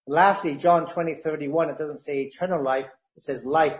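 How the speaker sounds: normal talking voice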